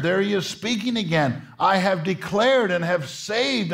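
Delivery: high, rising, fervent preacher's call; impassioned pulpit preaching; preaching at full force. Acted. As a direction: lift it and drive it home to the congregation.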